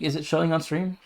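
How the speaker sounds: ordinary delivery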